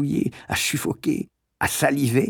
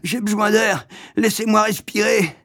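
glapissement; 9 semitones higher than usual. A narrator's reading. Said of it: "glapit l'amoureux".

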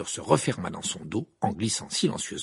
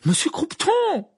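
very high, loud, stretched shout; frantic high yell; cried out; 8 dB louder, slower than usual. A narrator's reading. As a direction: shout the name in desperation. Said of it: "s'écria Harry".

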